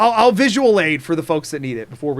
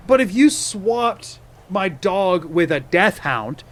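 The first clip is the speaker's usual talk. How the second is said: ordinary talk, nothing marked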